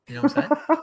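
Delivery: squeaky high mock question. Joking with co-host about accent spelling, squeaky high mock voice. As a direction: pitch way up as a joke.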